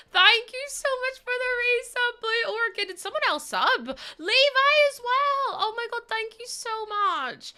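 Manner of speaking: high-pitched, gushing stream thank-you; excited thanks. Real, not acted. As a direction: squeal your gratitude at a surprise gift. Streamer reacts to new subscriptions.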